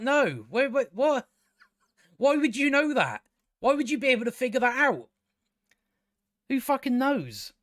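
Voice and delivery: high-pitched, incredulous ranting questions; exasperated disbelief. Real, not acted. Viewer challenging the character's guess about the deserter, exasperated. Ranting at a baseless plot conclusion.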